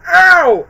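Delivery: high, loud imitated "ow"; mock pain yelp. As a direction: yelp like a hurt cartoon character. Imitating character's cry of pain.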